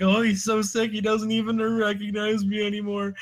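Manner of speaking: high whimpering grief, hammed up; mock weepy whine. Imitating the owner lamenting his sick dog, high and hammed-up weepy. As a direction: whine like a heartbroken pet owner.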